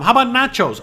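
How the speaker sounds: lightly voiced chirpy wife; mock wife. Wife suggesting nachos to husband, high and bright.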